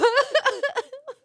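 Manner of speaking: squealing high laugh; high giggle. Real, not acted. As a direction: burst into a giggle. Laughing at own story.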